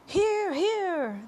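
high, swooping shout of approval; group cheer. A narrator's reading. All the creatures backing the ladybug's speech, high cheering shout. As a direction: cheer heartily, voice pitched high. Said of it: "they all shouted".

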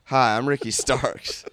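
cheesy ad opener. Pretend. Car ad opener.

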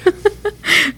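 high giggle; high-pitched delighted laugh. Real, not acted. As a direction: bursts into a high amused giggle. Amused by his accent.

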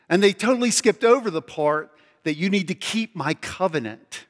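ordinary delivery

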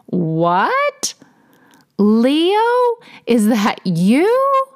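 as if seeing a ghost of your cat; astonished gasping question; shocked surprise. Acted. Bonnie sees the cat alive.

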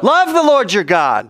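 high, drawn-out ringing call; with gusto. Shown as a demonstration. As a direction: lead the congregation in a full-voiced prayer call. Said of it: "say it with some gusto".

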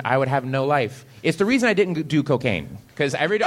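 usual speaking voice

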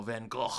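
Van Gogh with a throaty final ch; guttural Dutch ending. Real, not acted. Host echoes co-host's Van Gogh, guttural Dutch ending. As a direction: end Gogh in a Dutch-style scrape. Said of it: "gonna say it that way".

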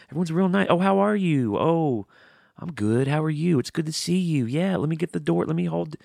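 high, singsong mock pleasantries; sugary Southern niceness.

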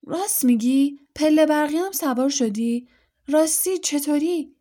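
an eager father firing questions; eager excitement. Acted. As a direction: Mr Weasley, fascinated by Muggle escalators, asks eagerly. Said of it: "مشتاقانه گفت".

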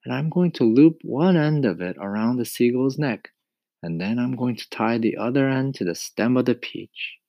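put-on boy voice. A narrator's reading. James explains plan.

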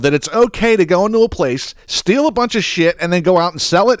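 squeaky put-on voice, mocking the shoplifting mom; mocking the mother. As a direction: sneer it in a silly high voice. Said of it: "She said".

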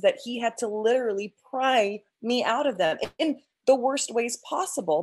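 ordinary talk

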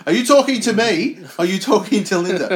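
high, loud mock indignation; hammed-up challenge, half laughing; mock outrage. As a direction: fling it out high and loud as a play-acted confrontation. Mock-voicing outraged wrestler.

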